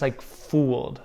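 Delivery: usual speaking voice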